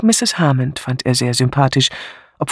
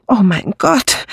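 a slow, breathless gasp of alarm, higher; 8 semitones higher, 3 dB louder, slower than usual. A narrator's reading. Miss Murgatroyd startled by the alibi question, gasping. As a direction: catch your breath, flustered. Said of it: "keuchte Miss Murgatroyd".

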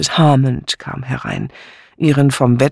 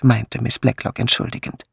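usual speaking voice

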